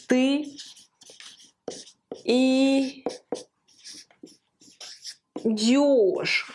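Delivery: drawn-out syllables with long gaps; sounding out slowly. Shown as a demonstration. Teacher writing out the conjugation, slow syllable-by-syllable. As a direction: stretch each syllable for learners to copy.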